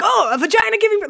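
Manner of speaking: high, quick startled exclamation; mock surprise. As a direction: pretend to stumble on a shocking sight. Imagined shock in a donut shop.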